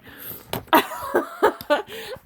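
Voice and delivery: real laugh at her own joke; high laugh. Real, not acted. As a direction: burst into a high, loud laugh.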